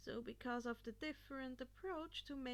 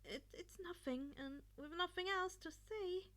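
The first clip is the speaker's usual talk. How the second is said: tearful; 6 semitones higher than usual. Acted. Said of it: "tears filling his eyes".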